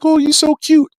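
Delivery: put-on high gushing baby coo; a hint of a cooing stranger. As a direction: gush over a cute baby, way up high.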